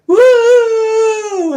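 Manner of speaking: a high, loud whooping cry from a passing vehicle; punk whoop. Acted. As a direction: whoop it out like punks hanging off a speeding car.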